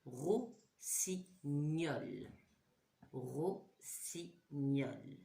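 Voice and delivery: Rossignol drawn out slowly, syllable by syllable; teacher to class, stretching Rossignol slowly; slow syllable demo. Shown as a demonstration. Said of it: "Est-ce que j'entends mieux dans rossignol?".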